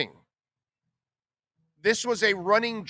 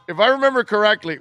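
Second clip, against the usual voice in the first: higher and louder, pushing the point; emphatic restart; 5 semitones higher, 6 dB louder, faster than usual. Real, not acted. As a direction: announce it to the room. Restarts the line with more force.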